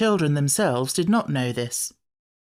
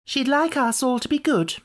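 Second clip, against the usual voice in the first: put-on higher, a touch prim little girl; 10 semitones higher than usual. A narrator's reading. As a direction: say it primly, like a good child. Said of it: "said Jane primly".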